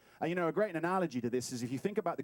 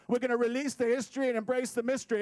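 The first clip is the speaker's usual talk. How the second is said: high, fast ring-announcer patter; boxing promoter; 7 semitones higher, 4 dB louder, faster than usual. Pretend. Promising to release the history, boxing promoter. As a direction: call it out like a fight promoter. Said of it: "It's my boxing promoter moment".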